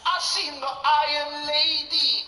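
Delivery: high, slow, loud youth voice; the cocky teen, dragging it out; rude boy youth. Retold or imitated. Youth telling his mate what he watched, slow loud rude boy. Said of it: "It went a little bit like this".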